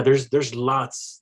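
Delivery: ordinary talk, nothing marked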